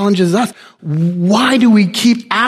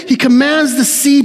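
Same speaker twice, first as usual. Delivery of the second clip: ordinary talk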